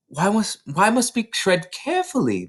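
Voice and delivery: put-on high, bright child voice tumbling with excitement; eager little girl. A narrator's reading. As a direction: play Matilda bursting to tell her teacher. Matilda questioning teacher.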